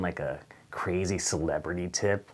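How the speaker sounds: ordinary delivery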